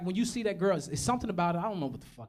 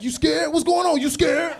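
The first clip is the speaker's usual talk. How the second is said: put-on taunting girl; 11 semitones higher, 10 dB louder, slower than usual. Girl taunting him after kiss.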